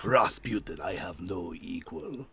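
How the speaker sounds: slow, low, menacing Russian AI; grand, solemn Russian boast; Rasputin voice. Pretend. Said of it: "that was really boring".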